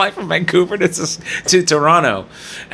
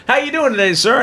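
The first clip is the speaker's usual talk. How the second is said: co-pilot; 8 semitones higher than usual. Retold or imitated. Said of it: "he comes over to me and goes".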